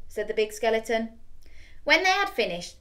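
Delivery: usual speaking voice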